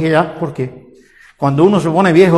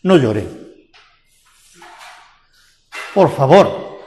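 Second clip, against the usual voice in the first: gentle comfort; 6 semitones lower, slower than usual. Retold or imitated. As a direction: comfort a grieving mother gently. Jesús consuela a la viuda.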